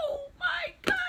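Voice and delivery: very high-pitched re-enacted squeal; starstruck shock. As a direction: act out being floored by a crush arriving. Learns her crush has arrived.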